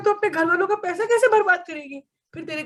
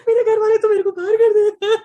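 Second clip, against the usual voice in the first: mock sobbing, higher and much louder; hammed-up wailing; 6 semitones higher, 7 dB louder than usual. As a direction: wail it like the world has ended. Failed twelfth, comic wailing.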